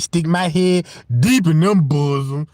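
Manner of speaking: normal talking voice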